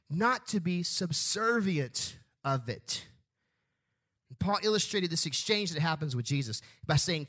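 ordinary talk, nothing marked

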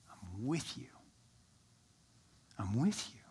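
soft, hushed comfort to a hurting friend; almost breathe it, close and gentle; Jesus quietly comforting Paul; tender reassurance. Acted.

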